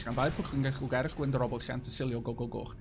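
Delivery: long Welsh name rattled off quickly; fast Welsh. Shown as a demonstration. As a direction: run the whole Welsh name together fast. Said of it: "it's because I'm Welsh that I talk fast".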